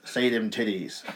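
high-pitched crude impression of a wrestling commentator; mock commentator. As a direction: ham up a rude American caller. Impersonating the commentator on phone.

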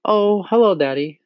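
put-on high, sweet little-girl voice; light, high and friendly child; pleasant greeting. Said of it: "she said pleasantly".

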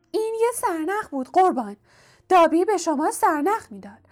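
high-pitched house-elf explaining; elf stating the obvious. A narrator's reading. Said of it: "گویی جواب این سوال بدیهی بوده است و گفت".

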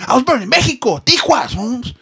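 high-pitched, loud mock old man calling out. Old man in a wheelchair calling out to the runner, high and loud. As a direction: yell like a grumpy old man flagging someone down. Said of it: "there's this old man".

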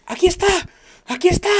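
high, loud cry of discovery; imitated shouting. Wife finding the paper in the files, shouting. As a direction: yell it out twice like you found it. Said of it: "la empiezo a escuchar gritando".

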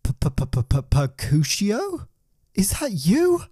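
stuttering, then a high astonished question; stammering surprise. Acted. Shakespeare in his cell, amazed to find his cat, stammering surprise.

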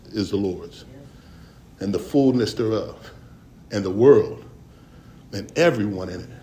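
solemn scripture reading. Acted. Quoting scripture, deep solemn.

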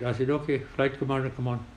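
usual speaking voice

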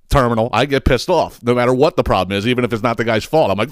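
ordinary delivery

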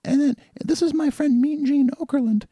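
goofy cartoon wrestler voice; Hulk Hogan impression. Guest mocks Hulk Hogan to the host in a high put-on voice. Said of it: "talks like this".

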